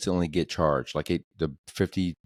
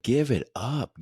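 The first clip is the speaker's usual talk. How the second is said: exasperated brush-off; 6 semitones higher, slower than usual. Retold or imitated. Telling off a pushy salesman.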